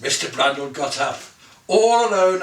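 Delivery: usual speaking voice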